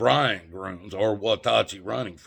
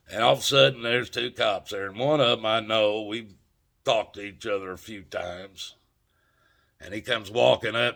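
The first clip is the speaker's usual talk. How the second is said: ordinary delivery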